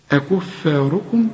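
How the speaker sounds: أكفاركم slow, light fa; careful tajweed reading; slow recitation. Shown as a demonstration. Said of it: "ما أقولش أكفاركم".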